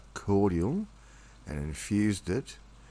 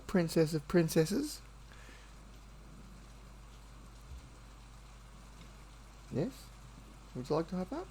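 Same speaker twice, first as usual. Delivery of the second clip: cooing pet talk; 5 dB quieter than usual. Real, not acted. Soothing his nervous cat sweetheart, very high, soft coo.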